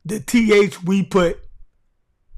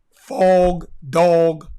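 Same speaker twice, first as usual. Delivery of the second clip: ordinary talk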